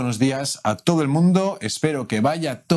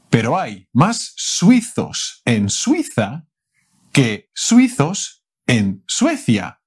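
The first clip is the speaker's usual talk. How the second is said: tongue twister said slowly; slow careful take; 5 dB louder than usual. Shown as a demonstration. Teacher repeating a tongue twister slowly. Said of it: "luego más tranquilito".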